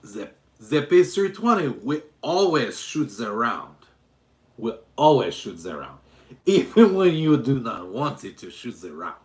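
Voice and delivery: loud, emphatic mock Eastern European accent; Baba Yaga. Streamer as Baba Yaga mocking the pistol, loud put-on Eastern European accent. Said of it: "some kind of Eastern European fluke".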